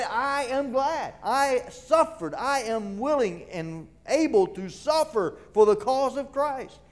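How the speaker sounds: high, drawn-out preacher's declaration; glad proclamation. Acted. Preacher voicing Paul's willingness to suffer, high and fervent. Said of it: "he talks about them in a way".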